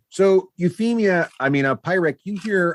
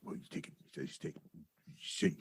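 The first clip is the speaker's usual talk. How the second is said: goblin whisper; 13 semitones lower, 22 dB quieter than usual. Acted. Goblins mutter among themselves.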